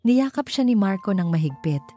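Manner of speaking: ordinary delivery, nothing marked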